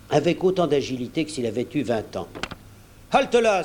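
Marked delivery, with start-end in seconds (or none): 3.1-3.6 high, sharp order to stop; commanding shout, pitched up; cried command. A narrator's reading. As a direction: cry halt with authority. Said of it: "s'écria Silver".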